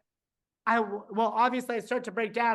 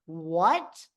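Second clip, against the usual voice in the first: shocked what; 5 semitones lower than usual. Retold or imitated. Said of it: "You can literally hear me go".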